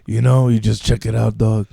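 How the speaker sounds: put-on low voice, dropping off at the end; Joey Diaz. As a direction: imitate a comic handing out advice. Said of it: "That was my Joey Diaz impression".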